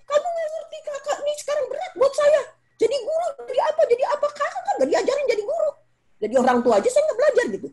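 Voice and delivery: high, fast, tearful complaint; exasperated mother. Retold or imitated. Selfish parent unloading on her child, high fast tearful complaint. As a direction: rant like a worn-out mother. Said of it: "Jangan egois ya".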